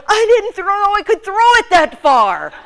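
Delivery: high, full-voiced comic yell; high, loud hammed-up outburst; loud mock amazement. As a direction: shout it in disbelief at your own throw. Amazed yelling in retold story.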